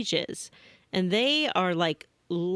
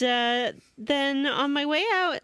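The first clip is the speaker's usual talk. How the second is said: ordinary delivery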